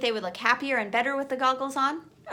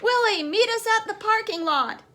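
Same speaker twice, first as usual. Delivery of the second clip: high, called out down a pipe; yelled; 10 semitones higher, 4 dB louder than usual. A narrator's reading. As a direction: yell it to carry down the pipe. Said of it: "he yelled through the pipe".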